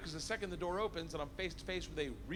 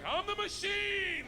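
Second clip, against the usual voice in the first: high, drawn-out roar; hammed-up battle cry, slow and high; bellowed boast; 5 dB louder, much slower than usual. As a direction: roar it proudly, stretching every word. Repeats his boast to gangster.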